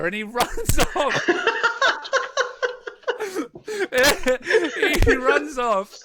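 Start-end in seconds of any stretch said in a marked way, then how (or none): 0.0-1.3 words breaking into laughter, louder and slower; can't get it out for laughing; laughing through the punchline. Real, not acted. Laughing while telling the theft. 1.3-5.0 high, loud, wheezing burst of laughter; high helpless laugh. Real, not acted. Loud laugh after the punchline.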